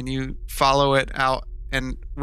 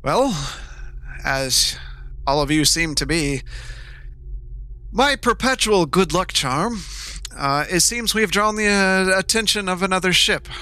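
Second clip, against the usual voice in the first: lightly voiced pompous captain; a hint of a grand sea captain; ship captain voice; 5 semitones higher than usual.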